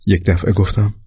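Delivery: plain reading voice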